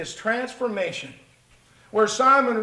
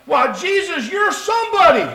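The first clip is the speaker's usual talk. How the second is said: much higher and louder, hammed-up wonder; mock awe; 10 semitones higher, 9 dB louder than usual. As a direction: gush like an overawed disciple. Peter mocked in awe.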